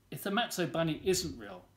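ordinary delivery, nothing marked

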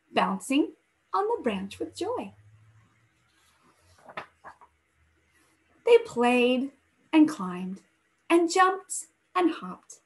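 gentle storybook read-aloud voice; story narration. A narrator's reading. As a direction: read it like a bedtime story for the class. Teacher reads picture book aloud.

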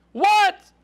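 loud, high-pitched disbelief; shocked outburst. Acted. Hanging man told to let go, shocked outburst. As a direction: burst out, aghast at the order.

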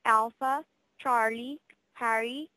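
normal talking voice

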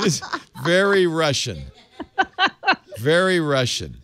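said through real laughter; amused, laughing repeat. Real, not acted. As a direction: chuckle out the words. Laughing over the icy clip.

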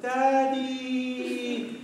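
put-on high, long-drawn child's greeting; excited little children. Children greet dad coming home, sweet child voice. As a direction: imitate kids sweetly calling out, stretched long. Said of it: "and they're like".

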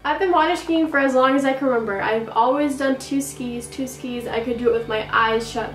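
normal talking voice

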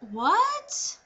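high, rising, stunned what; disbelief. Retold or imitated. Patient blindsided by her doctor's baby, disbelieving. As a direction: let the question shoot up in bafflement.

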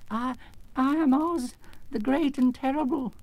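high, timid, stammering little man; trembling voice. Acted. Exposed little man introducing himself, trembling. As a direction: falter through a grand title, pitched high. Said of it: "said the little man in a trembling voice".

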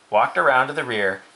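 ordinary delivery, nothing marked

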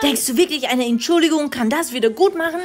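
höhere, genervte Mama-Stimme; Mama schimpft. Acted. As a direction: als aufgebrachte Mutter höher sprechen. (Mutter findet Tochter im Schlafzimmer, höher und genervt)

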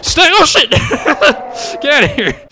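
high, loud, panicky shouting; startled screaming. Real, not acted. Player attacked by the ghost, startled screaming. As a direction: shriek and shoo the ghost away.

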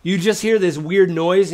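ordinary talk, nothing marked

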